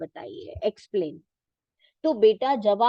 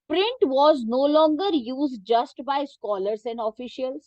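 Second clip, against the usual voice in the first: reading a textbook sentence very slowly for students to follow; teacher reading the book aloud, slow and measured; 3 semitones higher, much slower than usual. Shown as a demonstration. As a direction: dictate it slowly, word by word.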